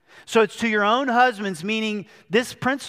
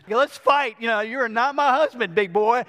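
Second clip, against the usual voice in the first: higher, mock defiant comeback; riled-up retort; 3 semitones higher than usual. Daughter Christina firing back at the teen boy, mock defiant. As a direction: imitate a girl squaring up to a bossy boy. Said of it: "Christina riled up and she says".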